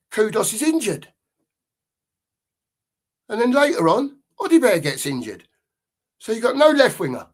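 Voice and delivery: real angry fan blowing up; furious rant. Real, not acted. Fan ranting about his club.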